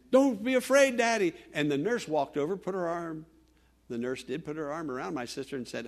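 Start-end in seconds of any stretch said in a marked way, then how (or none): 0.1-1.3 hysterical calling. Retold or imitated. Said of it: "She began to loudly".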